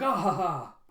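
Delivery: mock laugh. Flunky playing, laughing.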